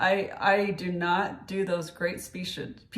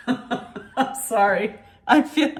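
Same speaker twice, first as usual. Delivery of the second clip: laughing; 3 semitones higher, 7 dB louder, slower than usual. Real, not acted. Laughs mid-sentence, apologizing.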